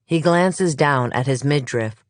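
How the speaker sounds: ordinary delivery, nothing marked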